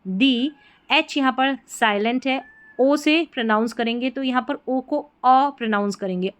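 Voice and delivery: ordinary talk, nothing marked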